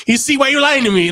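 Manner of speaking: high-pitched imitation of an indignant yell; mock yelling. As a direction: retell it as a mild, high yell. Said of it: "he's yelling at his friends".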